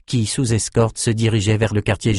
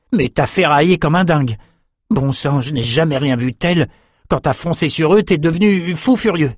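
put-on high-pitched, amazed young man; Jed, excited young companion; 6 semitones higher than usual. Jed telling Bannon how he fought, excited. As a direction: tell the feat with rising amazement.

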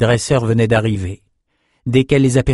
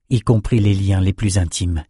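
ordinary delivery

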